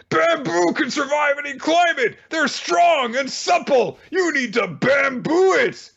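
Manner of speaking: hammed-up shouted motivational captain; captain's bamboo rant. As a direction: preach bamboo like a fanatic coach.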